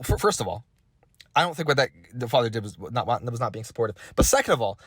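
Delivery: ordinary talk, nothing marked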